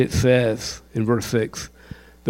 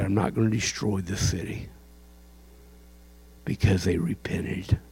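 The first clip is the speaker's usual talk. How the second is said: much softer, breathed whisper of God's mercy; hushed whisper; 6 semitones lower, 11 dB quieter than usual. Acted. Preacher voicing God's mercy to Nineveh, in a soft whisper. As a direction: drop to a near whisper.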